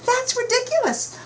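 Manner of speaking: very high protesting cry; barnyard animal squawk. Acted. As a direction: squeal it like angry farm animals. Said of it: "grunted, baaed, maaed, and honked".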